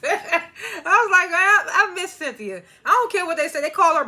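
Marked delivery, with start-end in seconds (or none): 0.0-2.6 high, laughing cry of I miss Cynthia. Real, not acted. Vlogger laughing as she admits missing Cynthia, high laughing voice. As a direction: laugh through the words, higher.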